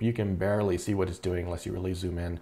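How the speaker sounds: ordinary talk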